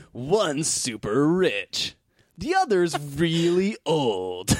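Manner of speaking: slow, high, dramatic trailer narrator; old-time narrator. Pretend. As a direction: announce it like an old movie trailer. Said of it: "an old-time narrator".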